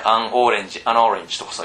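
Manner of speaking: an orange run together as アンオレンジ; linked pronunciation. Shown as a demonstration. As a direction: say アンオレンジ as one word, no break. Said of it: "こういうところつなげてさ".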